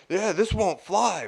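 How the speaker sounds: slow, high-pitched griping imitation; mimicked complainer. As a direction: draw out the stranger's complaint. Retold odd stranger complaining.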